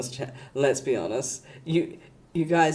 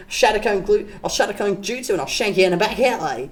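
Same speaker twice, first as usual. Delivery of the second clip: fast hammed-up Aussie bogan threat; bogan Aussie accent; 5 semitones higher, faster than usual. Aussie bogan threatens a shank in an alley, fast hammed-up accent. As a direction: rattle it off like a joking thug. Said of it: "the accent".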